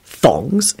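plain reading voice

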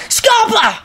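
high, urgent call; high-pitched urgent shout; shouted order. A narrator's reading. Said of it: "shouted a sixth year".

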